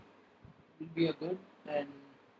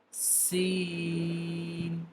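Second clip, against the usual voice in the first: usual speaking voice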